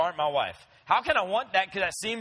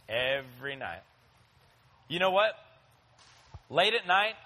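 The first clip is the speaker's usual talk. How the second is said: ordinary talk, nothing marked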